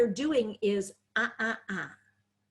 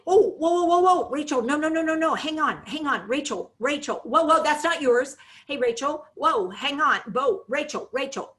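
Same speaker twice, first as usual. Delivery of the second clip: loud, high, alarmed repeated whoas; anxious whoa-ing; 5 semitones higher, 4 dB louder than usual. Shown as a demonstration. Caregiver loudly halting Rachel in alarm, high and urgent. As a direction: call out to stop her like she's about to fall. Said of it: "we use the person's name or we use these words over and over".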